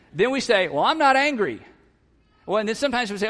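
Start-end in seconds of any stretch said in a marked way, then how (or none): 0.7-1.6 high, loud mock protest; protesting denial. Husband denying his feelings to his wife, protesting loudly. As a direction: insist on it, raised and emphatic. Acted denial of being angry.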